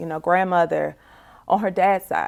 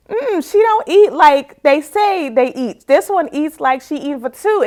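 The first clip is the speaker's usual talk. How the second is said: mock disbelief; 8 semitones higher, 7 dB louder than usual. Acting out amazement at niece's appetite.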